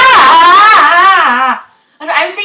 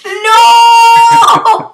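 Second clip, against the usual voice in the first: shouting; 8 semitones higher, 9 dB louder than usual. Real, not acted. [name] yelling.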